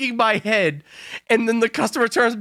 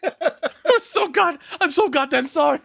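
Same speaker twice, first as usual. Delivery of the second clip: high-pitched imitation of a sorry worker; mock apology; 7 semitones higher than usual. Friend mimicking the apologetic McDonald's worker, high mock apology.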